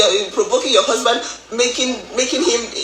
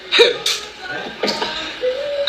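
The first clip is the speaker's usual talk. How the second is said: high unvoiced laugh burst; 3 dB quieter than usual. Real, not acted. Bursts out after anger.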